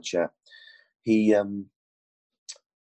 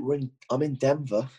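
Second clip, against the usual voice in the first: ordinary talk, nothing marked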